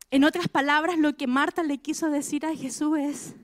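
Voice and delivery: usual speaking voice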